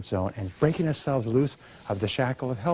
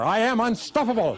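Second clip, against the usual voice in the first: much higher, exultant cry with a laugh; fired-up declaration; 10 semitones higher, 3 dB louder than usual. Shown as a demonstration. Speaker rallies the audience once more, exultant and laughing. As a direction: shout it out triumphantly, laughing. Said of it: "Once more".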